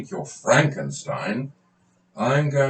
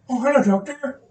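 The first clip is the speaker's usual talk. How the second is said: much higher, put-on voice of a timid patient; 4 dB louder than usual. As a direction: play the nervous patient, pitched well up. Patient figure enters.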